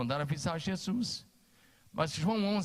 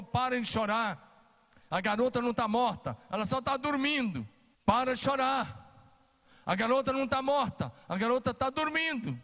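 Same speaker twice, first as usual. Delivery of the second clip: Jesus commanding; 6 semitones higher than usual. Acted. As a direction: order the crowd to stop crying. Jesus rebukes the mourners. (Jesus manda as carpideiras pararem, voz alta e firme)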